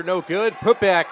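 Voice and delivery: usual speaking voice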